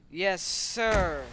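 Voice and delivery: loud, eager-to-obey reply; obedient henchman. Pretend. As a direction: call it out like a minion taking orders. Henchman obeys the boss.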